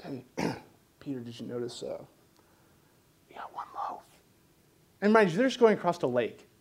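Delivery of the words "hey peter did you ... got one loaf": hushed, dropping to a whisper; secretive disciple. Acted. Disciple quietly tells Peter about the loaf, whispering. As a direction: lean in and whisper the worry.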